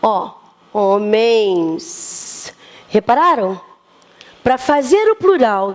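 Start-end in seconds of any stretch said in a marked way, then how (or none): 0.7-2.5 "homens" drawn out slowly, syllable by syllable; slow demo word. Shown as a demonstration. Teacher spelling out a plural for pupils, very slow. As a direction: stretch out "homens" so the class hears the N S ending. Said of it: "N S".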